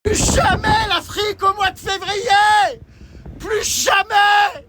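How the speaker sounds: slow, drawn-out high yell; shouting. Real, not acted. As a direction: bellow it into the wind. Freezing, yelling at the storm. (crier plus jamais, lentement)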